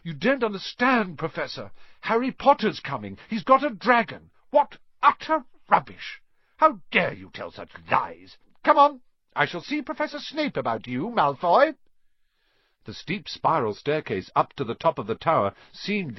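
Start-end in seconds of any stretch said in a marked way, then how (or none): 0.0-4.3 high, pleading schoolboy protest; desperate pleading. A narrator's reading. Malfoy pleading when caught. 4.5-11.7 high, stern headmistress scolding. A narrator's reading. McGonagall furious at Malfoy.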